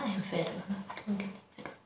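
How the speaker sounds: normal talking voice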